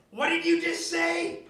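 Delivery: put-on high, loud, raging old woman's shout; drunk old lady yell. Drunk grandma threatening a relative at the party, high furious shout. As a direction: screech the threat like a furious tipsy granny. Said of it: "I hear this old lady voice".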